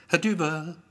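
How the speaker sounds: high summons, "Herr Düber" called as a statement; calling out. A narrator's reading. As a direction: call "Herr Düber" across a waiting room. Receptionist calls Gero's name.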